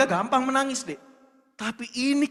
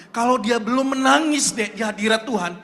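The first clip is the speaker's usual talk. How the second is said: ordinary talk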